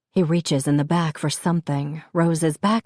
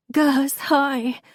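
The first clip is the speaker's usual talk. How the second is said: high, tearful hello; sobbed greeting; 9 semitones higher than usual. A narrator's reading. Crying mother greets a man she knows, sobbing. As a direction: greet him through sobs. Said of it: "I sob".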